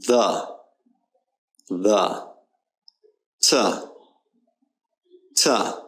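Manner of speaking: "memory, chocolate, the, to, an, of" one by one with weak uh vowels; isolated words, "the" as thuh, "to" as tuh, paused for repeating; schwa drill. Shown as a demonstration. Teacher leading repetition, schwa in "the, to, an, of". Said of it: "let's go through and repeat them".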